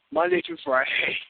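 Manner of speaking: high radio announcer plug; bright promo read. Acted.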